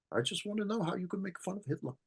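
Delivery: lightly voiced old lady not letting go; insistent old lady. Survivor repeats her question to Mel, quick insistent old woman.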